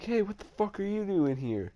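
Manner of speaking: high-pitched imitation of an aggressive challenge; mock cussing. Storyteller imitating the gang confronting him, mock cussing. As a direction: imitate their challenge up high, without shouting. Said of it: "fucking start cussing at me".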